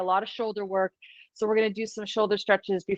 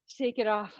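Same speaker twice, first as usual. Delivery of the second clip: usual speaking voice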